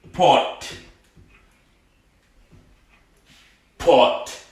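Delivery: "pot" with open "aw" vowel, pawt; open O example. Shown as a demonstration. Teacher gives an example word, "pot" with open "aw". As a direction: say "pot" as pawt, separately and crisply. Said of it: "An example for you is".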